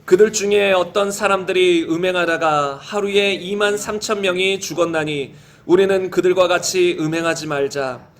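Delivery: low, even, measured read-aloud; scripture reading. Real, not acted. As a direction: read the verses in a low, steady chant-like cadence. Reads 1 Corinthians passage aloud.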